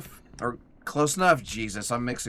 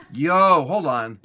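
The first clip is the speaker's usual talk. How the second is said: startled yo; 3 semitones higher, 9 dB louder, much slower than usual. Real, not acted. Sudden surprise during combat.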